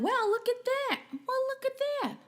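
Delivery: normal talking voice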